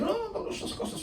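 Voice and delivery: very high, soft mock voice; mimicking know-it-alls. Preacher quoting know-it-alls declining things, high mocking voice. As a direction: squeak it softly in mockery. Imitates know-it-alls' answer.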